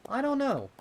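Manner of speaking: put-on very high, loud boyish voice; young colt voice. Pip voiced, unsure.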